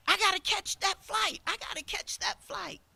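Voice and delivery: high, urgent pleading, a different voice; rushed traveller begging at the counter; frantic customer. Retold or imitated. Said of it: "A customer comes running in saying".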